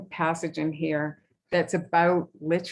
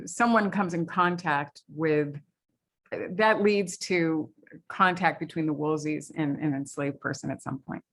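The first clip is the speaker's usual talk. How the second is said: ordinary talk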